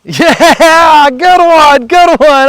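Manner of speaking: high, loud praise for the dog; excited praise. Real, not acted. Owner praising poodle's retrieve, excited and loud.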